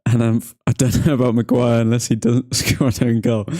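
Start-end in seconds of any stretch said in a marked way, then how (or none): none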